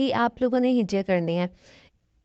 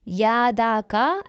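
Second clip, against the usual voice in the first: drawn-out wrong way; slow, dragged يادا کا to show the mistake; wrongly stretched; much slower than usual. Shown as a demonstration. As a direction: stretch the short vowel too long on purpose. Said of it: "ایسے نہیں کھینچنا ہم نے".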